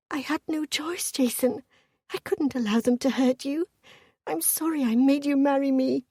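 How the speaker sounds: high-pitched, pleading woman's voice; pained, remorseful confession; distressed apology. A narrator's reading. Wife tearfully apologises to captive husband.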